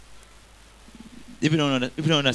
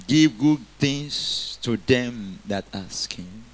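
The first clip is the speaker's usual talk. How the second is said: slow, deliberate answer to the question; much slower than usual. Shown as a demonstration. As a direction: spell the answer out slowly. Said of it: "how much more shall what?".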